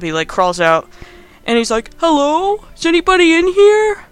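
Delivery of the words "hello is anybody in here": high, uncertain call into the dark; mock calling out. Adam calls out on waking in the bathroom, mock calling out. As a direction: imitate someone calling out, voice pitched way up.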